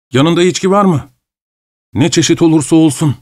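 high, eager pleading voice of a feverish man; pleading madman. Acted. Feverish madman begs the traveller, high and eager.

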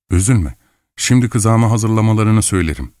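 ordinary delivery, nothing marked

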